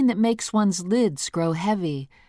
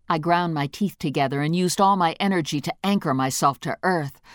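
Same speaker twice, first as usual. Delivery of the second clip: usual speaking voice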